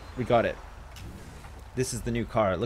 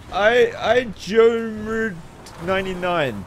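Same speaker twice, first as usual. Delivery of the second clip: higher, louder and very slow, a strange put-on voice; weird voice; 7 semitones higher, 7 dB louder, much slower than usual. Shown as a demonstration. Reading a subscriber's nickname aloud in a weird slow voice. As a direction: drag the name out in an odd announcer voice. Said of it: "that weird voice that I just used".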